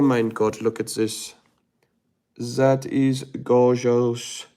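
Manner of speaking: awestruck, hammed-up European accent; mock-European gushing, building to loud; put-on European accent. Put-on foreign accent admiring France photos.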